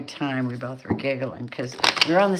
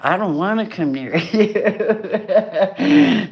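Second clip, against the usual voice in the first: slow, louder, laughing through it; laughing retort; 4 semitones higher, 6 dB louder, much slower than usual. Retold or imitated. Mocking the angry card figure, slow and laughing. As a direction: toss it back while cracking up. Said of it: "And you're like".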